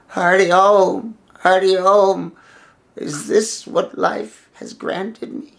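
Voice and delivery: slow, drawn-out wailing elder; pitiful old man. Acted. Old holy man approaches the clearing hungry, pitifully wailing. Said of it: "this pitiful, pitiful old man".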